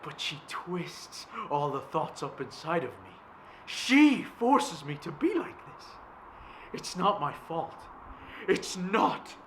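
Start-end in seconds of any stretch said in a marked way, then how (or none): 3.7-9.3 high, loud desperate cry; anguished shout. Acted. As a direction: burst out in anguish. Outburst, +10 st +12 dB.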